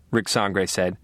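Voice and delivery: usual speaking voice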